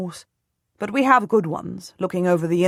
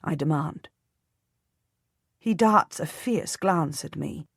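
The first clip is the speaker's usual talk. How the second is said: ordinary delivery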